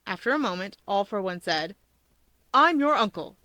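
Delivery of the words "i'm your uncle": higher, louder proud announcement; proud uncle to a child; uncle's greeting. A narrator's reading. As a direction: present yourself proudly. Villain greets nephew.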